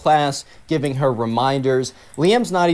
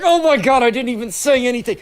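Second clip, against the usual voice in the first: exasperated outburst; 10 semitones higher than usual. Retold or imitated. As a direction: throw up your hands in exasperation. Liam exasperated at Kenna.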